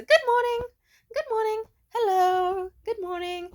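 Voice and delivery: very high, loud sing-out greeting; chirpy greeting. Retold or imitated. Desk worker greeting everyone but him, exaggeratedly chirpy.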